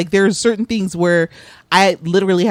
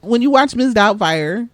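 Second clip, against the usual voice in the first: ordinary talk, nothing marked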